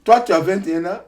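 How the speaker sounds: the clerk raising his voice in doubt. Retold or imitated.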